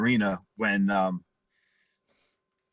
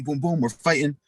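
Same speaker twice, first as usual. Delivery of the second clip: normal talking voice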